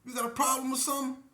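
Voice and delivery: high, fast challenging snap; fed-up, sharp confrontation; confrontational challenge. Retold or imitated. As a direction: call him out sharply. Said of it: "I looked over there at him like".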